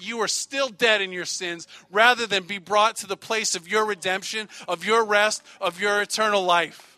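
full-throated sermon climax; preaching at full force. Acted. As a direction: thunder it out to the congregation.

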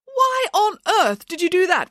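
very high, exasperated question; outraged protest. A narrator's reading. Nanny learning she's been entered, protesting. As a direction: protest in disbelief. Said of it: "protested Nanny Piggins".

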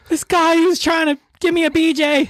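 high-pitched frantic complaint; squealed alarm to friends; retold panicked report. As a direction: blurt it way up high in mock panic.

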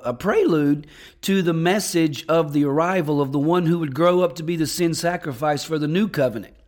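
usual speaking voice

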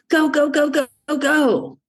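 high, rushed, breathless go-go-go voice; frantic New York hustle. Retold or imitated. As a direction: push yourself like a frantic New Yorker. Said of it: "New York productive is like".

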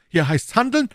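high-pitched call to action; rallying. Acted. Musketeers rally for the fight.

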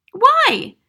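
put-on very high, loud child; cried out. A narrator's reading. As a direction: cry out for a reason. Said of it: "Matilda cried".

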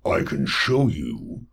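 low, slow monstrous croak; croaking slug creature. Acted. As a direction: croak it out like a bloated toad. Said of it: "the slug thing croaked".

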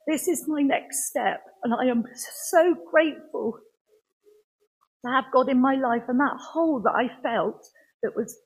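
pushing through rising emotion, near tears; emotional testimony. Real, not acted. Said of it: "I'm sorry".

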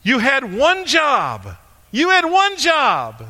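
high, slow mocking meme voice; sing-song scolding "you had one job"; high drawn-out mock scolding; meme quote. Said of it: "You see the meme".

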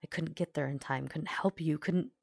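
usual speaking voice

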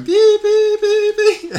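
very high, loud alarm shrieks; piercing, drawn-out electronic beeps; loud, intense beeping. Retold or imitated. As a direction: mimic a monitor at full alarm. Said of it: "It's getting louder and, and more intense".

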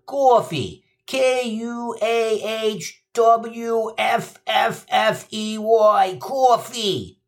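high, slow Queens spelling of coffee; New York accent. Retold or imitated. Gino spells the winning word, slow Queens accent. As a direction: stretch it out like a Queens kid. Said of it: "and says".

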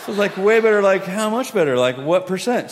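high, loud excited questions; eager questioning. Acted. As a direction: press eagerly for an answer. Eager questioning after healing.

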